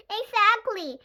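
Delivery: high, loud exclamation; emphatic agreement. A narrator's reading. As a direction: jump in loudly, vindicated. Chloe triumphantly agrees.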